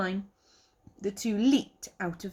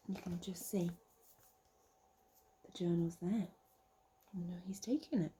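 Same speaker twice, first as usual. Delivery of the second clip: hushed worried whisper; whispered aside; 10 dB quieter, faster than usual. Real, not acted. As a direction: whisper it to the little listener. Reader's aside to the child.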